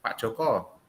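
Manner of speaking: ordinary delivery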